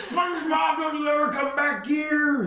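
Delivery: high-pitched cartoon scolding; hammed-up crab boss banishment; Mr. Krabs. Boss banishes fleeing SpongeBob, high cartoon yell. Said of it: "He says".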